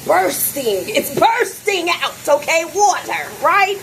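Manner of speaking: building outrage at the leak; excited exclaiming. Real, not acted. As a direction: make them see the mess. Showing leaking shower, exclaiming.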